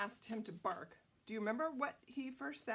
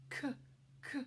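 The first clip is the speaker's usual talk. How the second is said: ordinary delivery, nothing marked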